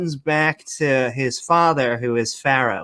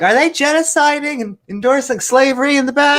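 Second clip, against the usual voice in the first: much higher, fast, nosy question; suspicious buyer; 12 semitones higher, 4 dB louder, faster than usual. Retold or imitated. Customer checking behind the counter, high and fast. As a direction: peek around the back suspiciously.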